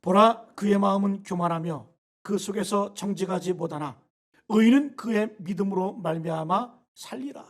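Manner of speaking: slow, loud solemn reading of the verse; scripture reading. Shown as a demonstration. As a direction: read the verse aloud slowly and firmly. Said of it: "시작".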